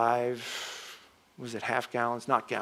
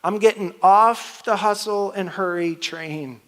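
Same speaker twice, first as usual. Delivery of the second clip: louder and higher, punching off; emphatic declaration; 5 semitones higher, 6 dB louder than usual. Pretend. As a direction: build it up, really mean it this time. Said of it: "stood up and said".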